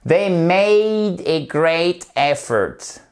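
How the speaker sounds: very slow, clearly spoken model line; slow model sentence. Shown as a demonstration. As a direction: spell out the whole sentence slowly. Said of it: "on fait toute la phrase correctement". (phrase modèle très lente et articulée)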